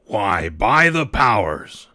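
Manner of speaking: slow, rolling pirate exclamation; Long John crying out. A narrator's reading. Long John protests to the crew, slow salty exclamation. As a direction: draw it out like an old sea cook. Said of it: "cried Long John".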